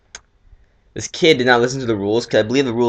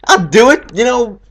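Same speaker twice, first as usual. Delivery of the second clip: mock macho offer; 12 semitones higher, 8 dB louder, slower than usual. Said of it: "So I'm like".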